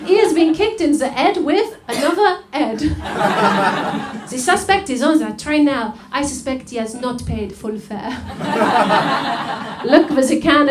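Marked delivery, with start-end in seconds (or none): 0.1-5.9 slow, badly faked French accent as Poirot; terrible French accent. Detective announcing the crime to the audience in a bad French accent. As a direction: ham up a French detective voice, slowly. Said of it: "a very terrible, um, French accent".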